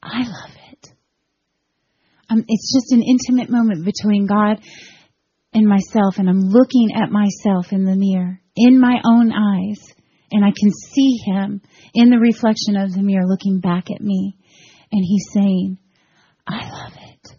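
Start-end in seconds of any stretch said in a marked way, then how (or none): 0.0-0.9 much softer, near tears; barely voiced, breaking with emotion; hushed, tearful. God speaking in her ear, hushed and tearful. 16.5-17.3 breathy whisper, no voice. Retold or imitated. God whispering to her in the mirror, a whisper. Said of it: "I could hear him so clear".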